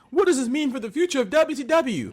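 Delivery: high, mock-dramatic commentator question; high-pitched hammed-up announcer concern; announcer imitation. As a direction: imitate an alarmed wrestling announcer. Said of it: "I forget who said it".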